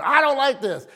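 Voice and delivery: wife throwing the child's complaint back, lightly acted; scolding wife. Wife to child at dinner, imitated scolding. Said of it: "my wife gets all over it".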